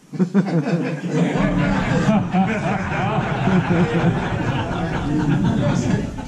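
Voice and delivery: big loud laugh at his own story; a real loud laugh; loud burst of laughter. Real, not acted. Big crowd-pleasing laugh.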